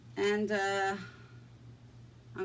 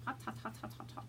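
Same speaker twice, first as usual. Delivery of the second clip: burnt fingers; 12 dB quieter, faster than usual. Real, not acted. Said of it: "But that's hot".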